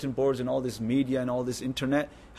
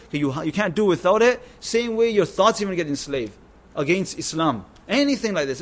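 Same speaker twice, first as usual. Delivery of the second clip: loud, rising sermon push, raised pitch; forceful preaching; 3 semitones higher, 6 dB louder than usual. Real, not acted. Preacher warning the gathering about enslaved thoughts, loud and forceful. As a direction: hammer the point home to the congregation.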